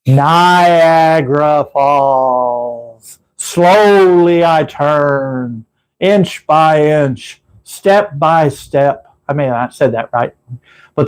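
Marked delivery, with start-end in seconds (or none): 0.1-3.2 slow, low, drawn-out stage menace; mock-menacing comedy routine voice; Three Stooges bit. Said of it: "you gotta go like this". 3.4-9.1 slow, creeping mock menace, word by word; hammed-up slow comedy threat; Three Stooges bit. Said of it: "you gotta go like this".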